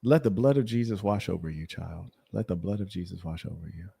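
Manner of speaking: hushed mock preacher blessing; lightly intoned church blessing.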